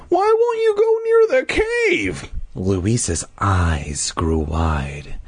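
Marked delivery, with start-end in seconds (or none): 0.1-2.3 put-on very high squeaky kid demand. A narrator's reading. Hero pressing the Sadlers about the cave, squeaky demand. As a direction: insist in a piercingly high put-on voice. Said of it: "I demanded".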